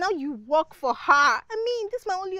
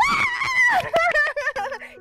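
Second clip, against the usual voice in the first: high, loud squeal breaking into laughter; excited squeal; 13 semitones higher, 5 dB louder than usual. Acted. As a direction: squeal with delight, then laugh. Friend agrees to ask.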